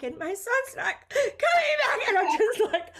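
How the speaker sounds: a mother losing it mid-story, half laughing, half crying; crying while retelling. Retold or imitated. Telling friends her son won't be home, voice cracking into a teary laugh. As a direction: voice breaks high into a tearful laugh. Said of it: "I started crying".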